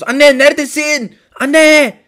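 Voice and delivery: high, loud cry for mom; calling out. Acted. Child calling for his mother, loud cry. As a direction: shout across the building.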